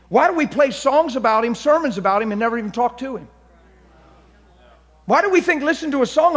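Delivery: loud, high, insistent challenge; full-force preaching. Real, not acted. Preacher challenging churchgoers who never talk to Jesus, loud and impassioned. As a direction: drive each question home at the congregation.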